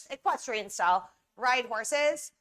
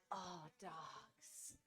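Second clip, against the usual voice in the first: soft cooing; 4 semitones lower, 6 dB quieter, much slower than usual. Retold or imitated. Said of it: "And I'm like".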